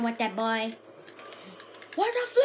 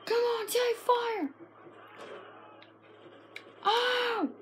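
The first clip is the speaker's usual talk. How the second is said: ordinary talk, nothing marked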